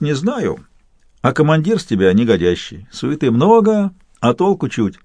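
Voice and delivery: cheeky soldier holding forth; Cossack artillerist voice. Acted. Pavlo answers Fedos.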